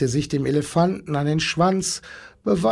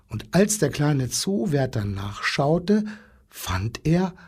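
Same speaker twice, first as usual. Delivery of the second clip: usual speaking voice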